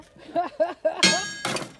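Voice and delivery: loud laugh. Real, not acted. Amused by the cat question, a loud laugh. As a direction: burst out in a high, loud laugh.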